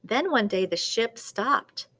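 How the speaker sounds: ordinary delivery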